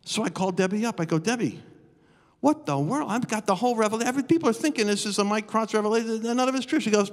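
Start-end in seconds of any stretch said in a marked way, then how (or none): 1.2-6.8 excited phone call. Retold or imitated.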